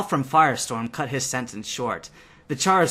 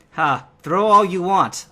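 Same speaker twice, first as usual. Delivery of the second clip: loud, slow, higher taunt; slow cocky jeer, louder; 5 semitones higher, 5 dB louder, slower than usual. A narrator's reading. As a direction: goad them, cocky. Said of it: "I taunted".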